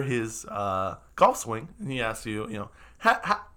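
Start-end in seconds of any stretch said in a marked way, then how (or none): 3.0-3.5 high chuckling small talk; chatty wizard. Acted. Wizard chats with the party about golf, high and chuckly. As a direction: make friendly golf chat, pitched up.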